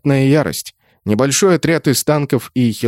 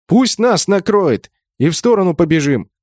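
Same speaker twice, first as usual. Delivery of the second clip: raised, urgent battle call; high, urgent instruction; calling out orders; 6 semitones higher than usual. A narrator's reading. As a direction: call a plan over the din.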